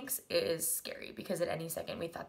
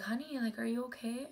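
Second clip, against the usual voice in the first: higher, soft-edged retold question; gentle concern; 5 semitones higher, 4 dB quieter than usual. To an injured little girl, gently concerned. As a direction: check kindly on a hurt child, pitched up.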